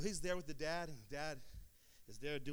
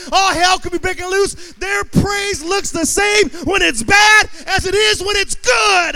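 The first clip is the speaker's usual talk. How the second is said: roaring, stretched-out preacher climax; full-force preaching; 9 semitones higher, 24 dB louder, slower than usual. Real, not acted. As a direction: build from a raised voice to a high, drawn-out shout.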